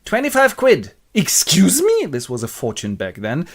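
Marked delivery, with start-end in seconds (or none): 0.0-2.0 high, loud put-on voices; theatrical bargaining, pitched way up; haggling figures. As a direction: trade it in hammed-up high voices. Wife haggles with Monks.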